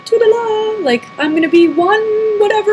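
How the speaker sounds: high, airy mock fantasy voice; breezy mocking toodaloo; mock sing-song. Mocking ideal weight fantasy.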